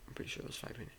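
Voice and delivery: half-whispered to himself; muttering. Real, not acted. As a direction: mumble it under your breath.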